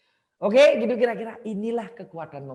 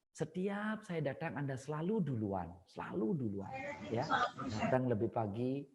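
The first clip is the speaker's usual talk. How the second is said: sincere thanks; 4 semitones lower, 6 dB quieter than usual. Shown as a demonstration. Demo of a proper compliment.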